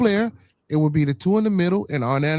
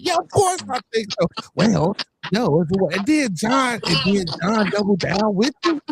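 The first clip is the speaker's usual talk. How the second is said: mock quote; 4 semitones higher than usual. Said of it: "listen to what you just said".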